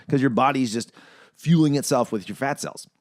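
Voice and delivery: ordinary delivery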